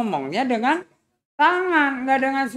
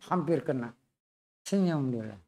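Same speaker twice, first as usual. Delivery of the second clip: ordinary delivery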